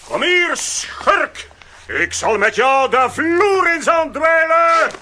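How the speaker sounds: slow, loud threat at the crook; tough-guy yell; shout. Acted. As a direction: bellow as he lunges. Grabbing the crook.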